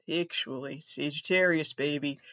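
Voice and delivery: ordinary talk, nothing marked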